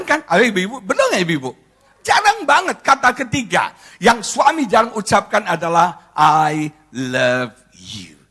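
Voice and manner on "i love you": very slow, low, drawn-out words; tender declaration. Acted. Husband saying the words he rarely says, slow and tender. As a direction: savour each word, low and slow.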